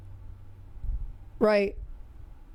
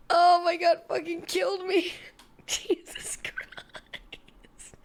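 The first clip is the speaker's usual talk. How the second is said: high, breathless words through laughter; helpless with laughter, voice pitched up; cracking up; 7 semitones higher, slower than usual. Real, not acted. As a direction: gasp it out between fits of laughter. Cracking up at a video.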